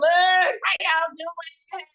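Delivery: high, slow stretched-out greeting; sing-song welcome to the viewers; drawn-out greeting. Real, not acted. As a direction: stretch it out like calling to a crowd.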